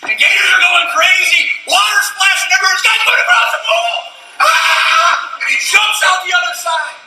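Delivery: very high, loud shouting chaos; breathless excited storyteller; frantic shout. Acted. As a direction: yell it like you're watching him swim for his life. Frantic retelling of crossing.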